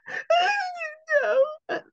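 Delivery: hammed-up gasp and wail, high and loud; fake distress. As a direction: ham up a fake collapse on stage. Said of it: "I'm gonna do my best acting".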